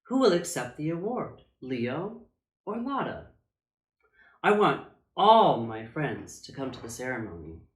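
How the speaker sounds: ordinary delivery, nothing marked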